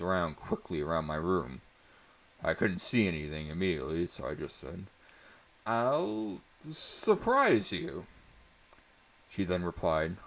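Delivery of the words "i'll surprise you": teasing. A narrator's reading. Teasing secret.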